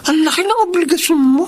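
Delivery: amazed. Acted. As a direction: marvel at how much she carries. Friend marvels at her burdens.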